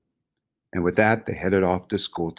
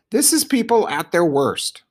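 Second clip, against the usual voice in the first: high-pitched indignant animal; high creature voice in protest; Bongo; 10 semitones higher, 4 dB louder than usual. A narrator's reading. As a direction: pitch the complaint well up. Bongo objects to egging.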